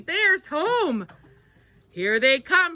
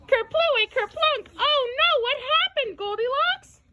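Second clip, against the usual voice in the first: much higher, breathless worried cry; excited alarm; 11 semitones higher than usual. A narrator's reading. Parent asking Goldilocks about the broken chair, high alarmed cry. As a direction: gasp at the crash and ask what happened.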